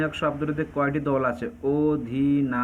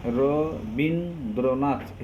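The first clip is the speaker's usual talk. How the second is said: slow, segmented Rabindranath, as র-বিন-দ্র-নাথ; syllable split; much slower than usual. Shown as a demonstration. Teacher points out closed syllables in Rabindranath, syllable split. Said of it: "এখানে বিন আর নাথ".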